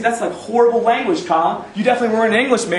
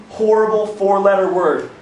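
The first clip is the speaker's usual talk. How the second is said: ordinary delivery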